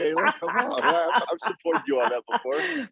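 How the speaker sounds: rally a friend in mock indignation; hammed-up, high-pitched call to arms; playful egging-on. Playful egging on, others laughing.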